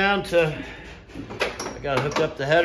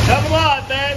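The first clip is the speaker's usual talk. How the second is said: loud coaxing; 6 semitones higher, 7 dB louder than usual. Real, not acted. Coaxing the engine to start.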